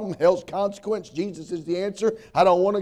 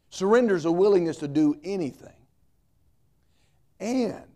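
usual speaking voice

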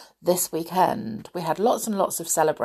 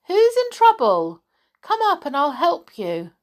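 high, light silvery fairy voice, calling louder; 9 semitones higher, 5 dB louder than usual. Acted. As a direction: call down sweetly like a tinkly bell. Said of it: "A silvery voice called down the tree".

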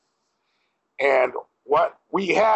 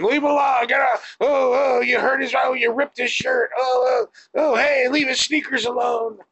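crowd yelling; 11 semitones higher than usual. Retold or imitated. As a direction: yell at the cops from the crowd. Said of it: "people just start mumbling and rumbling".

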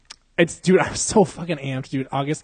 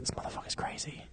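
much softer mock whisper; whispered aside; 17 dB quieter than usual. Actress listening to the podcast, whispered aside. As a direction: whisper it as a private verdict.

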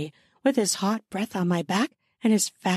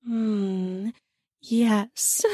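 moaning. A narrator's reading. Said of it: "I moaned".

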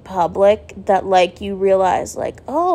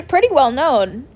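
ordinary talk, nothing marked

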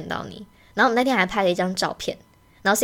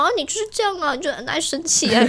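higher, mildly teasing imitation; imitating Cindy; 8 semitones higher than usual. Podcast host mimics guest teasing her, higher. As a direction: lightly mimic a friend's offhand jab. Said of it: "他就说什么".

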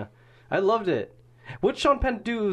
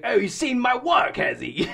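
mock Australian; 8 semitones higher than usual. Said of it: "He's like".

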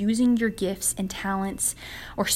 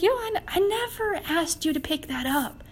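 high-pitched casual protest; lightly comic, put-on divine objection; Jesus; 9 semitones higher than usual. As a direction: push back in a raised, acted voice. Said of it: "And Jesus is like".

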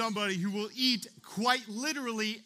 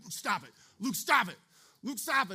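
mock angry brother; 4 semitones higher than usual. Big brother yelling at his pesky sibling, mock anger building. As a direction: imitate an annoyed big brother getting angrier. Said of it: "he'd be like".

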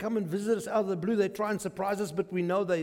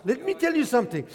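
ordinary talk

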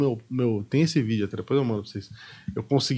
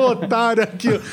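laughing mockery; 8 semitones higher than usual. Director mocking while laughing.

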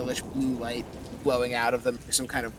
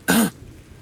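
throat clearing; 8 semitones higher, 9 dB louder than usual. Acted. Undead skull begins to speak.

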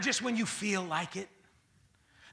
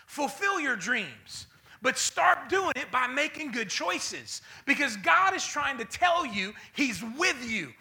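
slow, rising and louder; building preaching; 4 semitones higher, 7 dB louder, slower than usual. Acted. Preacher building up the church toward the promise. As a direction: climb to the big finish.

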